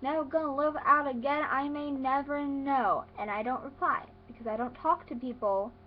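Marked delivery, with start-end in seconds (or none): 0.0-3.0 high, slow, drawn-out mock texting voice; texting little cousin. Mimicking cousin texting from mom's phone, mocking singsong. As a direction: read it like a gushing, badly written text. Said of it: "and she'll be like".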